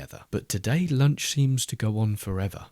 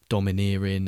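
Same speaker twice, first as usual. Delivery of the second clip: ordinary delivery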